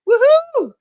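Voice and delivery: high loud woohoo; excited cheer. Real, not acted. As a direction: whoop with joy. Cheering a donation.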